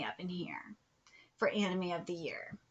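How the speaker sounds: whispered while scanning the list; soft, whispered reading aside. Real, not acted. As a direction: mutter it under breath while reading.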